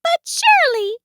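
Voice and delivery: high, squeaky protest; protesting mouse. A narrator's reading. Mouse objects to Bear, high squeaky voice. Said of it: "said Mouse".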